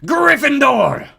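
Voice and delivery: loud, high drawn-out shout; hat shouting. A narrator's reading. The hat shouts its verdict to the hall. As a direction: announce the house with a booming cry. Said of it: "When it finally shouted".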